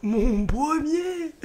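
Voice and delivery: slow high riddle chant; Père Fouras voice. Shown as a demonstration. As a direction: start the riddle like a sage. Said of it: "Père Fouras".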